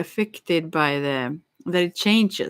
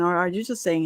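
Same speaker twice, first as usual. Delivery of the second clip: ordinary delivery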